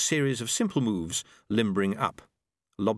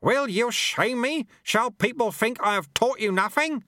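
put-on indignant old master; 13 semitones higher, 3 dB louder than usual. A narrator's reading. Lutze berates his apprentice, indignant old master. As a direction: shame the boy into obeying.

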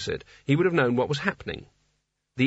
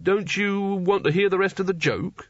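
mildly wounded, pitched up; pained; 6 semitones higher than usual. A narrator's reading. Rincewind's joke is cut short by Nigel, pained. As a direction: sound a little hurt at being ignored. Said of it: "in a pained voice".

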